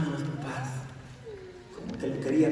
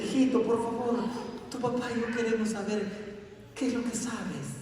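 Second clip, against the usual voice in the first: sweet coaxing mother; 8 semitones higher than usual. Acted. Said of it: "le habló bien bonito".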